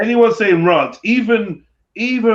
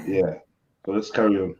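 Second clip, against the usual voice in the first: ordinary talk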